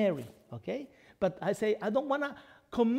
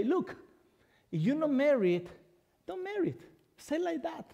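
raised, higher voice as Paul giving counsel; voicing Paul; 7 semitones higher than usual. Paul advising the unmarried, in a higher raised voice. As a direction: speak as the apostle, lifting the pitch. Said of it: "what does Paul want to let you know and say".

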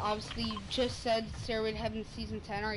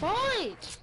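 shouting; 9 semitones higher, 4 dB louder than usual. Real, not acted. Excited gamer calling out fight.

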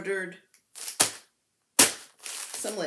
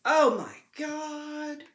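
gasp, then a slow "Oh my God"; real wonder; amazed gasp; 6 semitones higher, slower than usual. Real, not acted. Unboxing fabric.

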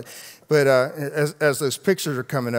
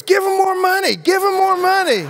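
much higher, louder excited shout as the wife; wife flattered, shouting to give more; mock shout; 10 dB louder than usual. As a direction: blurt it out thrilled. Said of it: "suddenly Kim goes".

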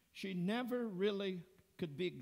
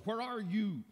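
angry husband; 5 semitones higher, 6 dB louder than usual. Retold or imitated. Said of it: "extremely angry".

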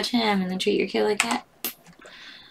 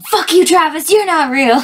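loud, high angry comeback; angry retort; 8 semitones higher, 7 dB louder than usual. Acted. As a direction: snap it back hard. Comeback shouted in meme.

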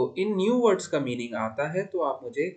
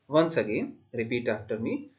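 ordinary talk, nothing marked

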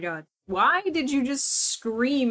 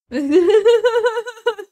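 loud, high peal of laughter; long helpless laugh; real belly laugh; 10 semitones higher, 10 dB louder than usual. Real, not acted. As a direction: crack up at the cat. Laughing at the cat.

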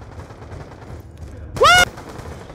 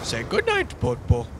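movie quote voice; 6 semitones lower, 4 dB quieter than usual. Retold or imitated. Quoting movie line, high voice.

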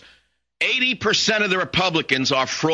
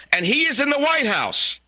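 indignant, much higher-pitched exclamation; outraged; 11 semitones higher than usual. Real, not acted. Radio host climaxes a tirade on a presidential adviser, outraged. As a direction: pitch it right up in disbelieving outrage.

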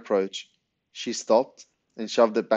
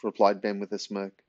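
usual speaking voice

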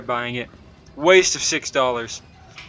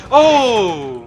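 startled yelp; 9 semitones higher, 5 dB louder than usual. Real, not acted. Jump scare in horror game.

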